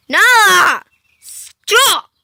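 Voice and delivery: loud scolding yell at the cat. Real, not acted. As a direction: tell the cat off.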